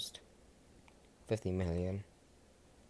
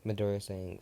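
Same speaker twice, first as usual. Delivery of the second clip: ordinary delivery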